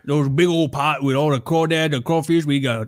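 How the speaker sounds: mock Cajun cook, words run together; slurry, impersonated Cajun cooking-show patter; thick Cajun accent. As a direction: imitate a hard-to-follow Cajun YouTube chef. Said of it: "needs subtitles almost".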